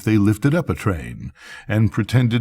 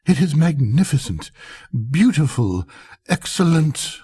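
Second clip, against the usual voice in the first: higher, fawning praise; gushing courtiers; 7 semitones higher than usual. A narrator's reading. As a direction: gush over nothing.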